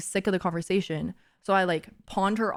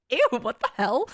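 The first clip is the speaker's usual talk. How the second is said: higher mock-disgusted "ew"; 4 semitones higher than usual. Reacting to the muffin message, playfully disgusted. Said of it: "And I was like".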